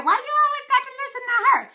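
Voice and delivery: high, loud, fast mock nag; nagging partner. Host mimicking a listener's partner complaining about her show. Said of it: "They partner in their ear".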